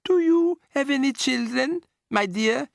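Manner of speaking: high, slow, sugary grandmother; sweet old lady. A narrator's reading. Grandmother politely asking the witch, sweet voice. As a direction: sound sweet and harmless.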